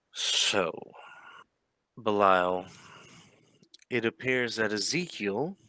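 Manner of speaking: deliberate phrases with long, baffled pauses; the Baron, dumbfounded. Acted. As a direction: measure out each phrase as a puzzled baron. Baron, dumbfounded, delivers his finding.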